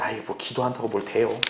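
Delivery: low, soft dismissive aside; inward scoff. Retold or imitated. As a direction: mutter it as a secret doubter mocking prayer. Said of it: "속으로 웃으면서".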